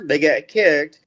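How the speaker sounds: usual speaking voice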